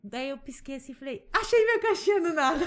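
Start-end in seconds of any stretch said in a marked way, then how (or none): 1.3-2.6 shouted high and loud through laughter; laughing shout. Retold or imitated. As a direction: yell it out in joyful discovery, laughing. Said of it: "eu comecei a gritar ali".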